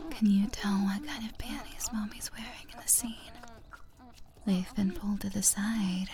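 soft intimate whisper; breathy ASMR whisper. Acted. Erotic roleplay whisper.

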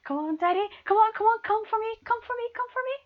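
climbing excited urging; urgent urging. Acted. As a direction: push them on, rising in pitch. Urging partner on, rising.

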